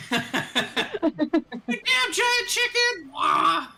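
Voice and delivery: very high, drawn-out shout breaking into squawks; laughing mock shout. Game master as bird becomes a chicken, high laughing squawk.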